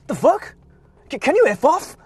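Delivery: high-pitched sneering parody of angry tourists; mocking angry tourists. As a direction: ham up the rude tourists' yelling. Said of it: "they went like".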